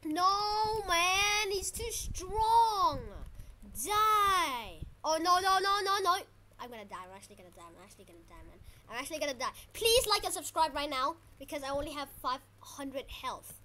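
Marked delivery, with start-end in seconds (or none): 0.0-6.2 loud, high despairing cries; desperate wail. Real, not acted. Gamer losing to a too strong boss, desperate wailing. 6.6-8.6 very low, soft muttering to oneself; resigned muttering. Real, not acted. Gamer about to die in the fight, low resigned muttering.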